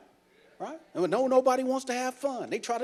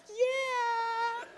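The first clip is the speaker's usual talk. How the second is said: mock moved; 14 semitones higher, 6 dB quieter than usual. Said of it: "You're like".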